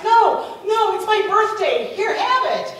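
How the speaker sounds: high, loud acted insistence; insistent generosity. Woman pressing money on her friend, insistent. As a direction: wave off the refusal emphatically.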